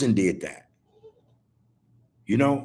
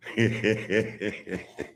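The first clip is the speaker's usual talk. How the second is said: soft laugh; 6 dB quieter than usual. Real, not acted. Laugh after TMI.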